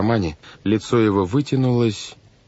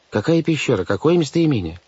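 higher-pitched baffled questions; protesting; 7 semitones higher than usual. A narrator's reading. Banker doubts the secret message, protesting. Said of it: "запротестовал мистер По".

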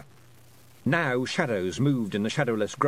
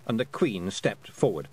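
ordinary delivery

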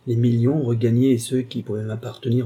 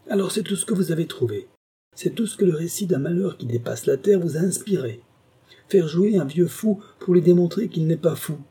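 much higher, fast furious outburst; roaring rage; 6 semitones higher than usual. A narrator's reading. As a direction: explode at them in fury. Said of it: "L'homme rugit".

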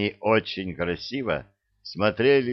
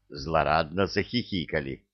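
usual speaking voice

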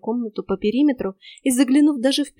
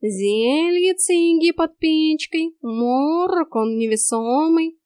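slow, stretched cat drawl, pitched up; talking cat; 6 semitones higher, 3 dB louder, much slower than usual. A narrator's reading. Cat offers the heroine a potion, drawling. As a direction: roll the words out long like a cat.

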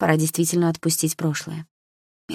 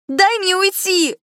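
very high, slow, loud shriek; desperate scream; 5 dB louder, slower than usual. A narrator's reading. Blonde begs her ex to let her go, drawn-out shriek. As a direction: drag out a frantic cry to be let go. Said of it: "закричала она яростно".

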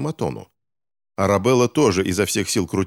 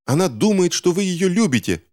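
put-on high-pitched indignant girl's outburst; exclaiming; 10 semitones higher than usual. A narrator's reading. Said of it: "воскликнула Арабелла". (высокое возмущённое восклицание)

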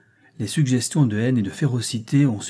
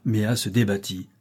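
ordinary delivery

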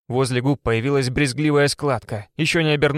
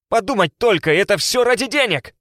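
shouted in outrage; yelling; 8 semitones higher, 4 dB louder than usual. A narrator's reading. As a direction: yell it at her. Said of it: "орал он".

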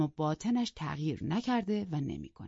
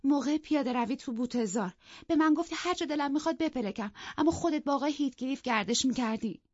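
put-on hurt young woman; 8 semitones higher than usual. Isabella voiced complaining.

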